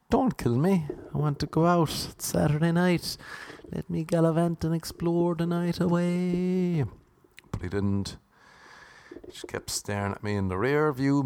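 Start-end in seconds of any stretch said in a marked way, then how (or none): 0.1-6.8 mock pleading. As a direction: plead in a hammed-up high voice. Mock plea to a feared murderous driver.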